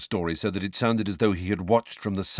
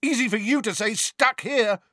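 high, raised taunt; bellowing. A narrator's reading. Fred bellowing a taunt at Sirius, high and raised. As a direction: hurl it at him in a high voice. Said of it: "bellowed Fred".

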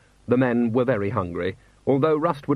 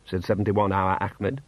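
plain reading voice

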